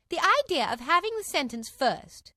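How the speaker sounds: high, loud protest; loudly. A narrator's reading. Alice objects to sentence before verdict, loudly. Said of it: "said Alice loudly".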